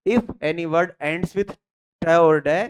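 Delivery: very slow, word by word dictation. Shown as a demonstration. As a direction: spell the rule out slowly for notes. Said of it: "you have to follow three conditions".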